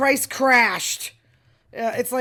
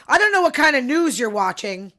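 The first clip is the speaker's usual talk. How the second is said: mock angry supporter; 3 semitones higher, 6 dB louder than usual. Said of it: "This is what they say".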